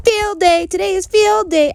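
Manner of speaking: very high, loud joyful yell; excited shout. A narrator's reading. Girl yelling at her sleeping baby brother, loud. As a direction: blast it right at the sleeping baby. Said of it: "I shouted at baby Ollie".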